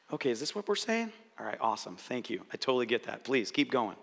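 calm the speaker and invite them on; gentle clarifying reply. Shown as a demonstration. Scene: coach modelling a reply to a talker, soft and reassuring. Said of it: "then take a moment and clarify".

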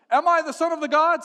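high, loud declamation; grand calling out. Acted. Alexander questioning the oracle's voice, booming out. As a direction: call into a great hall.

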